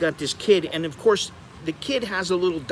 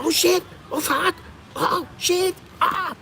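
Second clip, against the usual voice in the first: much higher, giggly panicked squeals; giggling panic; slower than usual. Retold or imitated. Friends in the speeding car, giggling panicked cries. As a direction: squeal in half-laughing fright. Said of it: "giggling and going".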